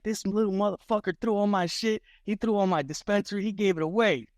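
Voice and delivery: imitating Chucky. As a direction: complain like a sulking rival.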